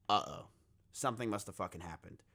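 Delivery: low, hushed dawning dread; uneasy aside. Retold or imitated. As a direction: say it low and soft, as a worried realization. Retold uneasy realization to himself.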